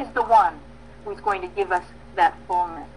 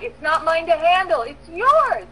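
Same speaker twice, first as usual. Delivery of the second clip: fervent surrender; 7 semitones higher, 5 dB louder, slower than usual. Real, not acted. Surrender cry to God.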